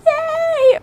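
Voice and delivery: high, drawn-out squeal of yay; mock cheer. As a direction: squeal it thinly, stretched out. Parents cheering child's first Italian.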